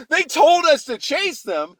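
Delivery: put-on high, far-off bratty kid voice; mocking big kids. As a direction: whine it like a smug older kid. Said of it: "the big kids were like".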